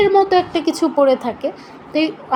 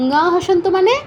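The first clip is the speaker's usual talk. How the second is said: normal talking voice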